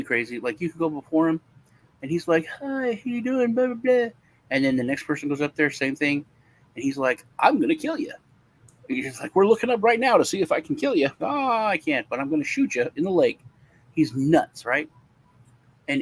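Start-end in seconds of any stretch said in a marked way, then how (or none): 2.6-4.1 high, breezy judge voice; mock the judge's cheery greeting; friendly judge. Judge greeting a defendant, high breezy mock voice. 7.4-13.4 high, wild judge threat; crazy judge. Retold or imitated. Judge threatening the next person, high mock threat.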